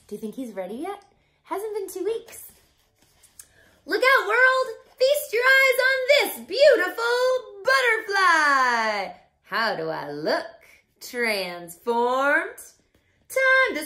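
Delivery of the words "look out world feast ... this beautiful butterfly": high proud shout; loud showy proclamation; triumphant shout. Acted. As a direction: announce yourself to the world. Triumphant announcement.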